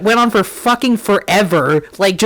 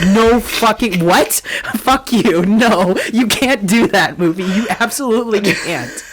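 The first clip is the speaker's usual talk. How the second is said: mild mock-outraged rant, a little raised; indignant retold protest, short bursts; retold outraged screaming; 2 semitones higher than usual. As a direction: re-enact yelling at the movie, half-laughing by the end. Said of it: "I was screaming".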